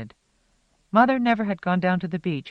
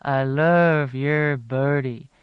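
thick throaty voice; 3 dB louder, much slower than usual. A narrator's reading. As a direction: croon it thick from the back of the throat. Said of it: "with that same thick back-of-the-throat sound".

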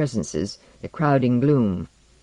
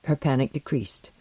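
ordinary delivery, nothing marked

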